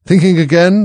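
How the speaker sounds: high, loud nosy duchess; lightly voiced duchess. A narrator's reading. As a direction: poke at her with the question. Duchess prodding Alice.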